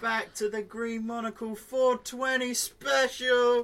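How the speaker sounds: loud high slow show opener; announcer hype. Acted. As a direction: welcome the crowd like a stage host.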